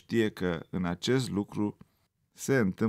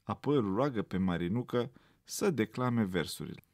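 plain reading voice

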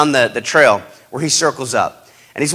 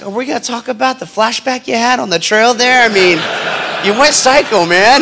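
higher, half-laughing disbelief; incredulous; 7 semitones higher than usual. Retold or imitated. Young recruit asking the seal about his outburst, incredulous. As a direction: call him out, amused and baffled.

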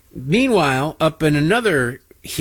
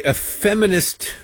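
ordinary talk, nothing marked

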